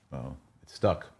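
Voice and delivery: normal talking voice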